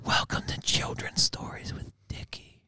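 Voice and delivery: eerie hushed storyteller whisper; creepy whisper. Pretend. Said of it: "That sounds creepy as fuck".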